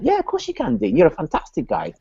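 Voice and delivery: gushing high reply. Retold or imitated. Own gushing reply to salesman.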